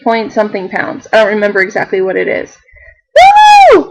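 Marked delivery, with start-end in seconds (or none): 3.2-3.8 high, loud whoop of joy; excited cheer. Real, not acted. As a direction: shout it out like you just won. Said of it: "I was so excited".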